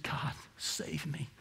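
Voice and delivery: hushed, slow whisper of "God, save me"; a broken man whispering to God; whispered plea. Retold or imitated. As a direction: breathe "God, save me" barely aloud. Prisoner's quiet plea.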